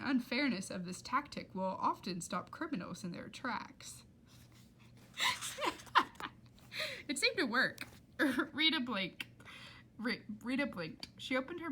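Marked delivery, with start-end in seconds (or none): 5.1-6.3 real laugh. Real, not acted. Reader breaks into laughter.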